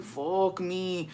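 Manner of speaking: soft, sinking curse; muted dread. Retold or imitated. Telling bro that grandma is there, muted dread. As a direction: curse softly to yourself in dread.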